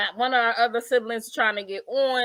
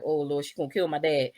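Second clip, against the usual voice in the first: worried aside; 8 semitones lower, 5 dB quieter than usual. Retold or imitated. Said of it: "I was like".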